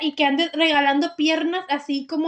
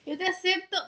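softer, sheepish mock vow; embarrassed quiet vow; 3 semitones higher, 8 dB quieter than usual. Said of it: "Qué vergüenza".

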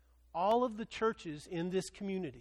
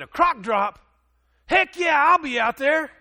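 much higher, much louder, thrilled; excited yell; 9 semitones higher, 13 dB louder than usual. Retold or imitated. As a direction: shout it like an adrenaline junkie. Bob's excited reply retold.

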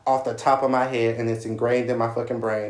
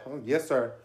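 softer, timid yes sir; meek reply; 7 dB quieter than usual. Retold or imitated. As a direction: answer like a scared player. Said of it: "did his voice".

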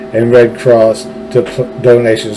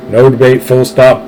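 ordinary talk

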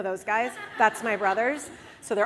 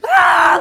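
loud, high scream of fright; terrified scream; 7 semitones higher, 14 dB louder than usual. Retold or imitated. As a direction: scream as if someone jumped out at you. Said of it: "screaming at the top of my lungs".

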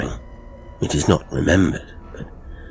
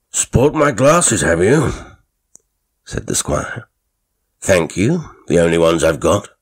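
put-on testy old squire; 4 dB louder than usual. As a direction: bristle at the guest. Squire bristles at Fanshawe.

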